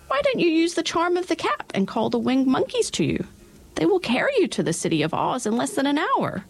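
high, squeaky little queen, kindly; a tiny queen helpfully advising; mouse queen. A narrator's reading. Queen of the Mice speaks.

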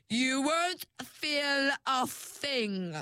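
high, slow, sinister purr; sinister villain. A narrator's reading. Perdita threatening Ringlet, slow and high sinister. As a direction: promise no pain with relish. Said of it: "said Perdita".

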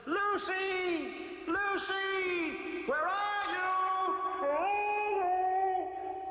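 high, drawn-out call into the distance. A narrator's reading. Children shouting across the field for their lost cow, calling out. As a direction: shout for someone lost, stretching the words. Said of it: "and they shouted".

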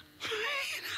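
high squeaky giggle. Real, not acted. Laughs after own squeak.

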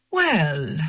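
put-on lightly sing-song accented English, drawn out; a hint of his lilting foreign English. A narrator's reading. Old man on the beach answers her in sing-song English. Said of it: "he says in his sing-song English".

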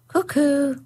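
high clock cuckoo chirp; cuckoo call. Acted.

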